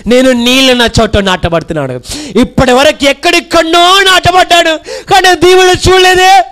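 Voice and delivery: shouted, high-pitched sermon lines; full-force preaching. Acted. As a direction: preach it out with fire to the crowd. Preaching climax. (పూర్తి ఉత్సాహంతో బోధన)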